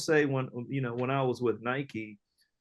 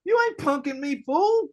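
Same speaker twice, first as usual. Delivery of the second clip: defiant put-down; 14 semitones higher, 5 dB louder than usual. Retold or imitated. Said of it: "I'm like".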